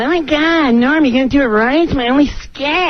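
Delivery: high-pitched pleading fuss; hammed-up, high, panicky complaint; Chris Kattan. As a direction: imitate a frantic castmate nagging. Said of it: "He's going".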